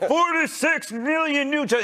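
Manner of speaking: high, hammed-up rally announcer; imitating Trump. Mocking Trump's rally cheer, high and hammed-up. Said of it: "He goes".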